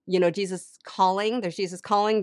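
ordinary delivery

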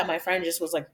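ordinary talk

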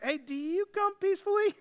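frightened elders greeting the prophet, hammed-up nervous; mock trembling. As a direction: ask it like scared townsfolk, voice jumping high. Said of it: "So they're trembling".

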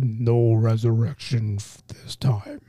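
low, slow whispered menace; hushed low villain threat; Thanos impression. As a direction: breathe it out like a cold titan. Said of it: "My Thanos impression".

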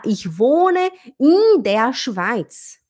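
"in" stretched like "ihn"; imitated learner slowly dragging "in"; very slow answer with "eeen" for "in"; wrong long i. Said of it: "assim que o nativo escuta quando a gente fala".

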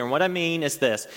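ordinary talk, nothing marked

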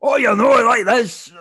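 put-on loud, very high parody Scottish accent; mocking Scottish accent. English people repeating it back, exaggerated mock Scottish accent. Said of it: "I get repeated back to me".

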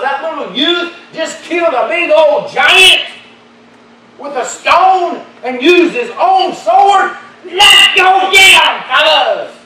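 loud, high climactic shouting; preaching at full force. Acted. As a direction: whoop it up to the church.

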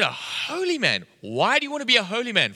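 high, playfully astonished questioning; incredulous echo. Retold or imitated. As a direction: echo it back in mock disbelief.

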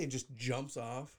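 ordinary talk, nothing marked

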